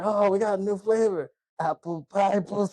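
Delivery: lightly voiced leering biker, high; mock sleazy biker. Retelling the biker's leer at Juliette Lewis, lightly voiced. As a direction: leer it out like a creep. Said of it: "He's like".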